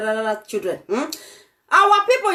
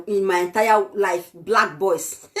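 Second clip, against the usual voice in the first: usual speaking voice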